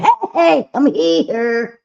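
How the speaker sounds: high, loud mock party entrance; attention-seeking double chin. Double chin announcing herself at the party, showy mock shout. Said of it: "She's like".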